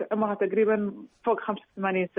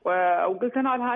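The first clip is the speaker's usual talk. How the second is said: ordinary delivery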